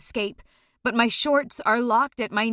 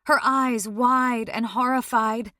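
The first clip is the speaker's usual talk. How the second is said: ordinary delivery, nothing marked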